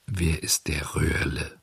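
low, slow, hushed marmot; quiet question. A narrator's reading. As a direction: drop soft and low to ask, unhurried. Calm marmot answers frantic puppet. (leise, tief und langsam gefragt)

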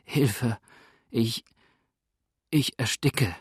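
stifled, halting plea for help; plaintive, choking character voice; feeble groan. A narrator's reading. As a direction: gasp it out piteously with long breaks. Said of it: "stöhnte er mit halb unterdrückter Stimme, leise und kläglich".

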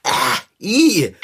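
spluttering on swallowed water; coughing up water. Real, not acted. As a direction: choke on a gulp of mouthwash. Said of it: "musste ich mein Mundspülwasser runterschlucken".

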